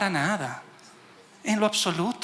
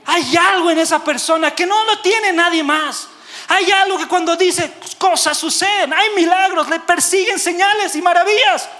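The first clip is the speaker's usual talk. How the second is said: loud, high impassioned preacher; full-force preaching; 10 semitones higher, 7 dB louder than usual. Acted. Preacher challenging the congregation, at full force. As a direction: drive each phrase out loudly to the congregation.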